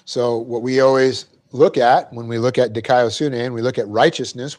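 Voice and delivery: ordinary talk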